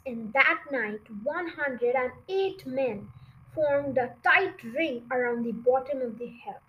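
ordinary delivery

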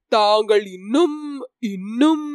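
faltering, hesitant repeat; stammering. Acted. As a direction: stumble over the words. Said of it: "தட்டுத்தடுமாறி பேசினான்".

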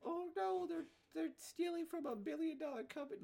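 high squeaky mock whine. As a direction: squeal it like a whiny complainer. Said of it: "they're like".

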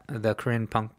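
ordinary talk, nothing marked

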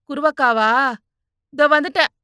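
loud, high-pitched call; calling back. Acted. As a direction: answer someone from afar. Answers someone calling her from outside.

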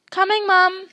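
high, loud answer to mother; calling back. Acted. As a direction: shout back that you're on your way.

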